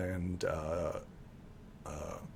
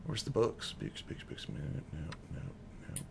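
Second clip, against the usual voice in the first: very soft, half-whispered searching mumble; barely voiced, absent-minded repetition; muttering to oneself; 7 semitones lower, 14 dB quieter than usual. Real, not acted. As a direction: mutter under your breath while hunting for something. Game master searching notes to self.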